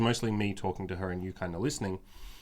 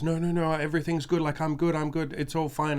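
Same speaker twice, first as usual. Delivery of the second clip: imitating the girl; 7 semitones higher than usual. Imitating the girl insisting to the couple she is fine, in a higher mock voice. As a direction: put on her higher voice to brush it all off. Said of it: "she wiped her eyes and goes".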